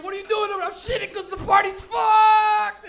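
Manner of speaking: high, very loud yelling, drawn out at the end; frenzied celebration shout. Acted. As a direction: holler like a party gone mad. Acted exchange amid wild celebration.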